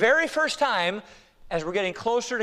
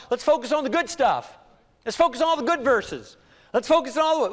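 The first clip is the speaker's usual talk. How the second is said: mock believer's protest, pitched up; higher-pitched imitation of a complaining churchgoer; insistent complaining; 4 semitones higher than usual. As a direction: plead to skip the bad news. Voices believers wanting only good news.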